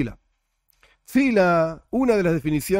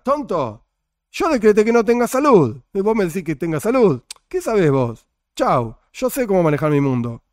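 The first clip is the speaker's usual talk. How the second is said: a loud mock scolding from above, higher pitched; grito imitado de Dios; 3 semitones higher, 5 dB louder than usual. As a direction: scold the foolish one like an annoyed God. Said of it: "me van a gritar". (Dios gritando desde arriba, regaño burlón y fuerte)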